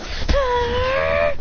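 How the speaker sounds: long high charging yell; battle cry. Acted. Two rivals charging their attacks, long high cry. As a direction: sustain a high anime "aah".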